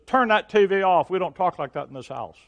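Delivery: loud call down to the family room; a wife calling out in sharp reproof; wife's stern call. Retold or imitated. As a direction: turn it off, loudly, from the doorway. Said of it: "she says".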